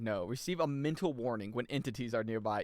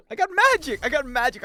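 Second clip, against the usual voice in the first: excited shout; 13 semitones higher, 13 dB louder than usual. Pretend. Gamer boasting about new magic.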